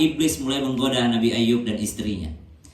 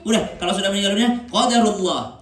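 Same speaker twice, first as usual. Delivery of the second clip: emphatic; 9 semitones higher, 6 dB louder than usual. Real, not acted. Preacher insists on accepting fate.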